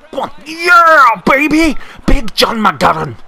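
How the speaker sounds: very high, loud cheer; ecstatic shout. Real, not acted. Gamer celebrating his scorer, loud high cheer. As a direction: roar the scorer's name.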